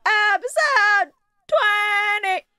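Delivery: high, long-drawn holler; shouted announcement. Pretend. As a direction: sing out the title at a stretched yell. Host calls out next episode.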